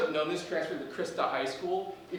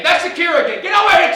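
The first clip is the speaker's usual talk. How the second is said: much higher, loud yell across the hall; excited shout; 13 semitones higher, 17 dB louder than usual. Acted. Principal shouts across the assembly to Shakira, loud and excited. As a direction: call her over at full voice.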